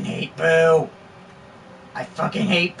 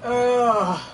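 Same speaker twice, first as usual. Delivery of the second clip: ordinary talk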